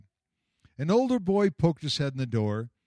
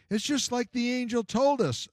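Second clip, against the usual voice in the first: plain reading voice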